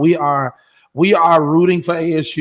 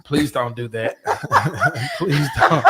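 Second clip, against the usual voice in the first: laughing plea; slower than usual. Real, not acted. Pleading through laughter.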